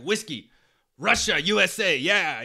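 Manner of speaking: a friendly Russian tourist toasting "Russia, U S A", giving thumbs up; Russian accent. Retold or imitated. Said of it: "He's like".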